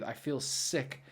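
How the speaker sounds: usual speaking voice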